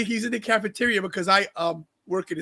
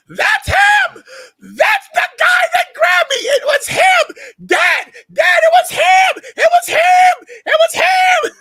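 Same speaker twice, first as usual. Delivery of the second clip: put-on high frantic screaming kid, much louder; 12 dB louder, much slower than usual. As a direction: scream it like a panicked child pointing out his attacker. Kid shouting in cafeteria.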